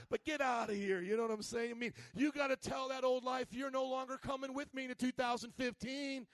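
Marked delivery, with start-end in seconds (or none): 0.2-1.0 put-on loud, botched Chicago-New York accent; hammed-up big-city accent, New York style; messed-up New York accent. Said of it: "messed up New York accent". 2.1-6.3 raised, forceful preacher voice; rousing pulpit declaration; fired-up preaching. Real, not acted.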